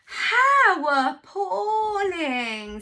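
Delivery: very slow, loud, drawn-out dismay; hammed-up farmyard gasp of dismay; dismayed chorus. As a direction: stretch it out in mock horror. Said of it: "said everyone".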